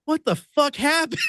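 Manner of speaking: high, stunned exclamation; shocked question. Retold or imitated. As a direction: blurt it out at the sight of the pants. Jason seeing ripped pants.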